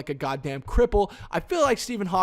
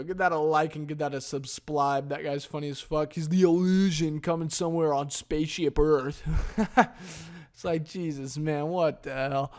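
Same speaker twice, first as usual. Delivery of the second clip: ordinary talk, nothing marked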